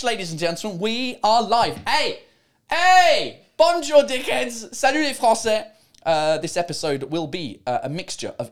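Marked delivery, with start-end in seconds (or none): 1.9-3.4 high, loud greeting shout; excited shout to viewers; loud hey. Real, not acted. 3.6-5.6 cheeky greeting. Real, not acted. Greeting French viewers.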